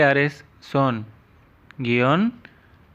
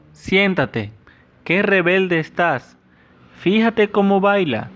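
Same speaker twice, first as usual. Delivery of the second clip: higher and a bit louder, exclaiming; exclamatory example; 5 semitones higher, 3 dB louder than usual. Shown as a demonstration. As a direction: read it as a lively exclamation. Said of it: "Se escriben para empezar y finalizar una oración exclamativa".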